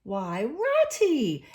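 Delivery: slow friendly exclamation. A narrator's reading. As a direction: welcome an old friend. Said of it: "in a much friendlier voice".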